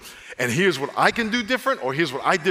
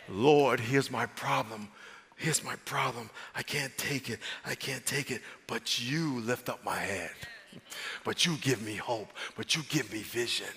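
soft, low, sincere prayer; quiet prayer; 4 semitones lower, 8 dB quieter than usual. Shown as a demonstration. Preacher models a humble prayer, soft and low. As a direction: pray it softly and lower, from the heart. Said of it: "quiet prayer demo".

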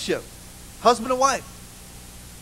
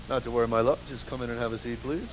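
low, soft gallant husband; soothing husband; 9 semitones lower, 9 dB quieter than usual. Retold or imitated. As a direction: lower and softer, courting his wife. Husband voiced in story.

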